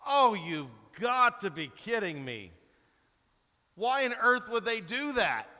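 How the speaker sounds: loud, exasperated disbelief at a bad play; frustrated yell at the TV. Fan yelling at the TV over a bad play, exasperated. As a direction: throw your hands up at the screen. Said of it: "I'm yelling at the T V".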